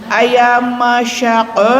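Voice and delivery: slow "Masako" with sh in the middle; sa as sya. Shown as a demonstration. Teacher drilling the middle sya sound. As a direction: say "Masako" as Mashako, slowly. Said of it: "Diganti huruf sa-nya yang tengah pakai sya".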